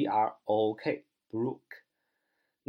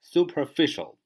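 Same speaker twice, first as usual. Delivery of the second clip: superficial with ficial punched louder; stress on ficial; 3 semitones higher, 4 dB louder than usual. Shown as a demonstration. Teacher showing learners where superficial is stressed, stress on ficial. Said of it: "重音在facial那儿".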